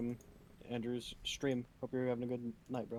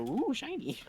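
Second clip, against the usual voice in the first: squeaky, much higher voice, dazzled by shiny metal; dazed delight; 13 semitones higher, 3 dB louder than usual. Retold or imitated. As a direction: coo it like a magpie spotting treasure. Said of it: "make him go".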